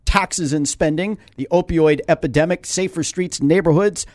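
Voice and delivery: steady radio ad read. Acted. Host reading the mayor's twelve-point plan, ad-read voice. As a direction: list the points like a sponsor spot.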